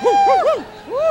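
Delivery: high whooping stadium cheer; sports fan cheering. Retold or imitated. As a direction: whoop like a crowd at a game. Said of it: "to take to a sporting event".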